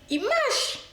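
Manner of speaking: sudden high thrilled exclamation; excited squeal. Real, not acted. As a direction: squeal with glee that it sells. Burger selling well, delighted.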